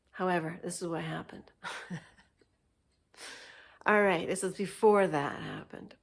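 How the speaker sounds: ordinary delivery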